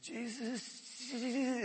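high, strained, soft attempt at a word; struggling young man. Young man trying to confess during deliverance, strained. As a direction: force the name out high and squeezed. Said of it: "it would just come out".

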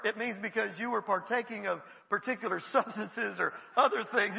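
ordinary delivery

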